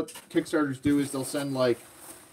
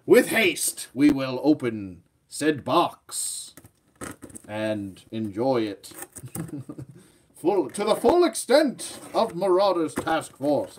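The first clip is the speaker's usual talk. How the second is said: lightly mock-archaic, slow and grand; Old English voice; slower than usual. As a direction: play a hint of a medieval herald opening a box. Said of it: "I'm speaking in Old English voice".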